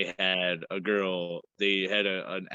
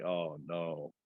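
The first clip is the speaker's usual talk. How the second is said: soft dread; 4 semitones lower, 8 dB quieter than usual. Retold or imitated. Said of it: "I was just like".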